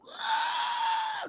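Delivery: very high, loud imitated scream; monster shriek. Monster bursts out.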